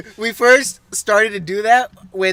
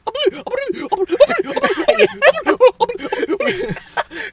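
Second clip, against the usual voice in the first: high, panicky gibberish babble; Courage the Cowardly Dog. Acted. Friend being the dog nobody understands, high panicky gibberish. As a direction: stammer out high frantic dog noises. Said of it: "Courage, the dog".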